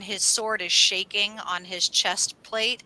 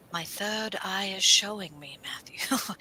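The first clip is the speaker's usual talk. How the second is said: breathy mystic whisper; hushed mock oracle voice; 5 dB quieter, slower than usual. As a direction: whisper it like a secret vision.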